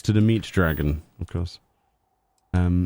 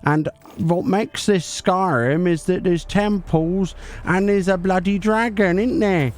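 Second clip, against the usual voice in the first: higher, bright-eyed 'hi guys' YouTuber hype; hammed-up YouTuber intro voice, pitched up; parody YouTuber voice; 5 semitones higher than usual. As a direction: play an over-eager clickbait YouTuber, guys after every phrase. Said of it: "We'll make our own Easter egg video then".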